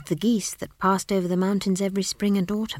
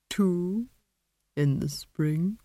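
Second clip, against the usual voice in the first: suggestive; much slower than usual. A narrator's reading. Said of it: "something lascivious about the way the tree said".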